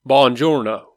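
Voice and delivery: one-word hammy Italian-accent impression; mock bad-Italian accent. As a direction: have a go at mangled movie Italian. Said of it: "you gotta do this one".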